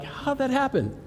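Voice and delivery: high-pitched mock bafflement; baffled. Hacked speakers react to the scam, imitated high bewilderment. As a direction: imitate a stunned victim asking.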